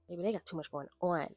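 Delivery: usual speaking voice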